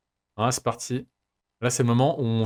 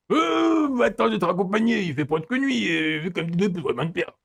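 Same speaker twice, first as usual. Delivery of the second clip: voix de personnage composée, qui part très haut puis redescend; garçon du jeu qui propose de raccompagner; 5 semitones higher than usual. Acted. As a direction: propose en traînant la voix, doublage pour rire. (voix jouée de personnage de jeu)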